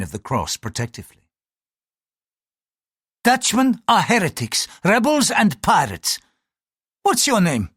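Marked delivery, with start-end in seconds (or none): 3.2-7.7 high-pitched denunciation; accusing priest. Acted. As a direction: denounce him, then demand an answer. Priest denounces, then interrogates.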